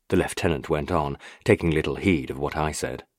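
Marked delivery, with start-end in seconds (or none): none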